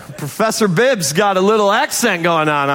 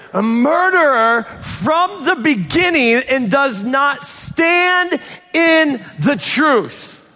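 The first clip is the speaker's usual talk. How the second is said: high, drawn-out, emphatic preacher's cry; forceful preaching; 7 semitones higher than usual. Acted. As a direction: hammer out the accusation word by word.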